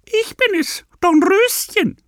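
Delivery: very high, sweet fake girl's voice; wolf faking a little girl in falsetto; wolf at the door piping high to pass as a girl; disguised voice. A narrator's reading. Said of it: "mit verstellter Stimme".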